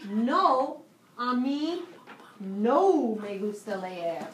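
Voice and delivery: slow, deliberate no me gusta leer; teacher dictating the negative answer slowly; slow model answer. Shown as a demonstration. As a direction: spell out each word for the class. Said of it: "There we go".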